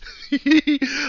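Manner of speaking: high, loud giggle; laugh. Real, not acted. Teasing that everybody loves her except Hedge, giggling. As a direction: giggle high at your own joke.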